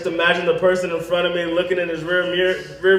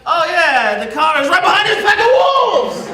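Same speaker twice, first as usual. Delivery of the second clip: high, laughing, strained excuse; sheepish excuse; 11 semitones higher, 3 dB louder than usual. Pretend. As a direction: squeak out the lame excuse. His own reply in bit.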